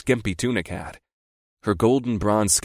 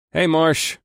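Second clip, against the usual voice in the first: mild greeting, pitched up; mild, higher-pitched hello; mildest voice; 7 semitones higher than usual. A narrator's reading. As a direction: greet your boss as mildly as you can, voice raised in pitch. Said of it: "I said in my mildest voice".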